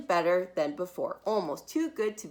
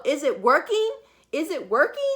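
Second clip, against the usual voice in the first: high, loud calling; yelled through door; 6 semitones higher, 5 dB louder than usual. Acted. Mother yelling through the door to her daughter. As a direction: call through the bathroom door. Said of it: "stood outside the door and yelled".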